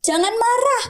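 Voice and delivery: high-pitched mock parental reprimand; scolding mother. Mother stops her child voicing anger, mock scolding. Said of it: "seorang anak mau ngomong marah sama ibunya".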